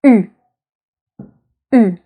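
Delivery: "U" as a tight front rounded vowel; French u sound. Shown as a demonstration. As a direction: say "U" as ee through rounded lips. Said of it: "the sound".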